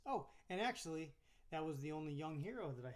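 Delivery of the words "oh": ordinary talk, nothing marked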